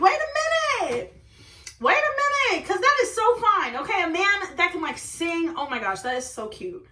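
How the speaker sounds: high, giddy swooning over a singing man; gushing excitement. Retold or imitated. Retelling to viewers how she swooned over a singing guy, high and giddy. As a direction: swoon like he just melted you.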